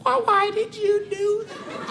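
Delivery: put-on old woman asking why he knocked her down; old lady's plea. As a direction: play a hurt tiny old lady. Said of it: "She's just like".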